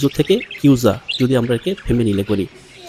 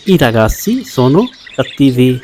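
very slow, each word laid out clearly; slow teacher demo; slow model reading; much slower than usual. Shown as a demonstration. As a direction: spell the sentence out slowly for learners. Teacher models Italian sentence.

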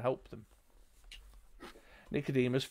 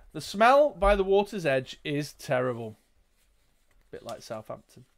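usual speaking voice

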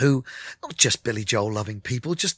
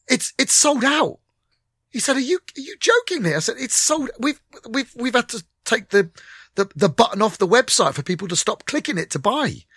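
high, excited rush of news; bursting to share the news; thrilled disbelief; 9 semitones higher than usual. Retold or imitated. Breathless news of sell-out.

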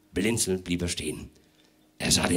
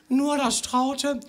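timid hostess voice; slower than usual. Acted. Hostess helping out with lyrics.

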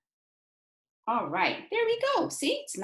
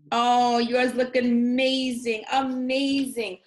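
excited praise; 8 dB louder, slower than usual. Acted. Teacher praising the children's crafts.

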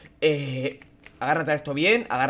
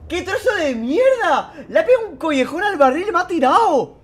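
indignant outcry; 13 semitones higher, 6 dB louder than usual. Real, not acted. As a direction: wail it like you can't believe it. Complaining the enemy knocked him. (queja indignada, aguda y alargada)

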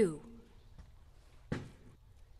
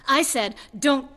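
angry warning; 4 semitones higher, 6 dB louder than usual. Acted. Warning him off a painful memory.

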